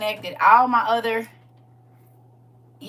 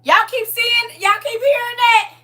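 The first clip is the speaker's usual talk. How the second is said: excited high; 12 semitones higher, 12 dB louder than usual. Real, not acted. Asking viewers about voice.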